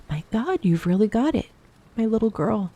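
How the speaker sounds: moved amazement. A narrator's reading. Mother hears of daughter's first period, moved amazement. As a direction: marvel at it like a proud parent.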